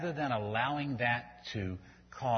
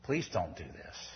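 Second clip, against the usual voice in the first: pleading parent; 3 semitones higher than usual. Retold or imitated. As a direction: plead gently, heart breaking. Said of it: "you start pleading with them".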